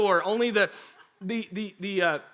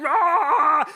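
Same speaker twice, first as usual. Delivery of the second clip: loud, high mock roar; lion roar; 8 semitones higher, 8 dB louder than usual. Brother keeps annoying his sister, loud lion roar. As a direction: drown her out with a play roar. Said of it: "roaring like a lion".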